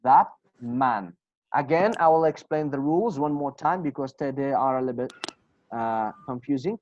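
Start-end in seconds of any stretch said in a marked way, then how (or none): 0.0-1.1 "that man" as "thap man", falling tune; changed t sound. Shown as a demonstration. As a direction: turn the t of "that" into p before "man". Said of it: "We will change it to".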